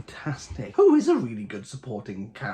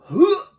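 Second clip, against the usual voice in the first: sharp gasp; 11 dB louder than usual. Real, not acted. Tasting a strong beer, reacts.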